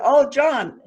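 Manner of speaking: bright, lifted "Oh, John" in a cheerful voice; peppy happy talk. Retold or imitated. Wife asking husband for help, peppy and happy. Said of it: "she tried to create kind of a peppy talk, a happy talk".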